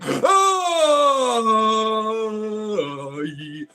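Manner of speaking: long high wail sliding downward; hammed-up drawn-out howl of the exorcised; mock demon wail. Demon rebuked in Jesus' name, mock wailing.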